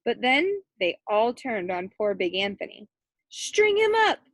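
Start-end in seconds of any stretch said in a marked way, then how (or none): 3.3-4.2 high, loud angry mob shout. A narrator's reading. Angry townmen turn on Big Anthony, high loud mob shout. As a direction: demand punishment like a mob. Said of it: "the townmen shouted".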